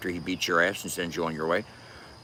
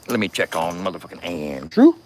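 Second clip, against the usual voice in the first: ordinary talk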